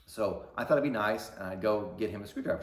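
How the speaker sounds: ordinary talk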